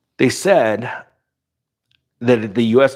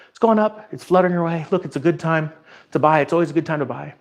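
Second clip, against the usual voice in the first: much higher, louder chirpy sales pitch; mock cheerful salesman; 8 semitones higher, 3 dB louder, faster than usual. Mocking an upbeat realtor pitching homes to buyers, high and chirpy. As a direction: play a bubbly realtor hyping the market.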